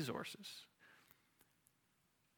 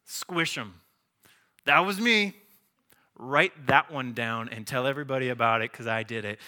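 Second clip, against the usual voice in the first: loud, swaggering boast, big on "me"; mock boast as God; 8 dB louder than usual. God brags to Gideon about crushing the camp, loud swaggering. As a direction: crow about it like a show-off.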